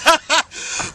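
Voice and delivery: bright burst of laughter; laughing. Real, not acted. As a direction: laugh out loud at the joke. Laughs at own joke.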